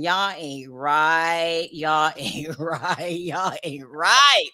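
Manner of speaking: slow, low, sing-song y'all ain't right; drawn-out teasing scold. Real, not acted. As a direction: stretch it out, playfully scolding the gossips.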